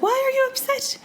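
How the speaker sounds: very high-pitched, puzzled question; baffled husband. Acted. Husband questioning his sleepless wife, baffled and very high. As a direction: put on a husband's squeaky bewilderment. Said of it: "and he's like".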